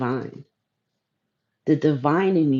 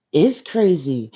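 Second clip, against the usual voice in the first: ordinary talk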